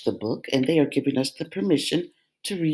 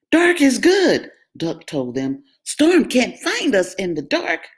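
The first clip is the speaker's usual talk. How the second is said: much higher, louder duck voice; 7 semitones higher, 5 dB louder than usual. A narrator's reading. Duck reassures the scared animals. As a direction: reassure them like a bossy duck.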